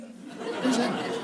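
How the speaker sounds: breathy, drawn-out puzzled whisper; slow it right down, half whispered; hushed slow repeat. Real, not acted. Puzzling over a baffling slogan, slow whispered repeat.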